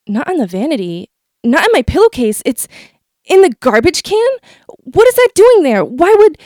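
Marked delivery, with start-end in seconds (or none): 2.5-6.4 high, loud and alarmed; can't believe what she sees; shocked disbelief. A narrator's reading. Panicked discovery.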